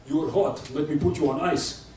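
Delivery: deadpan Russian pickup line; Russian accent. Acted. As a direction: hit on her in a Russian accent. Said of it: "happens with Russians".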